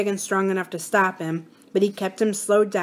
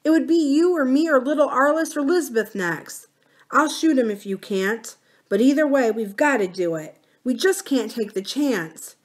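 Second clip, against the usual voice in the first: higher, a touch of tearful pleading; mild sobbing; 6 semitones higher than usual. A narrator's reading. Mama tells her son the dog must be shot, mildly sobbing. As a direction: hold back tears as you insist. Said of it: "she sobbed".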